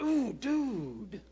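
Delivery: drawn-out wince of sympathy on "Ooh, dude"; sympathetic wince. Shown as a demonstration. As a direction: wince for a buddy who fell on his face. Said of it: "he face plants".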